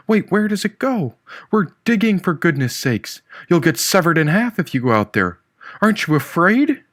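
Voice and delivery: put-on high, urgent girl's voice; character voice. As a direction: lift the pitch, alarmed and pleading.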